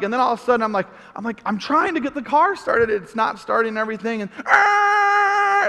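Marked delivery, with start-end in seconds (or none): 4.5-5.7 long, high, loud horn blare; mock horn held out loud and high; imitated car horn. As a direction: lean on it like a horn. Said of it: "he's just blaring his horn".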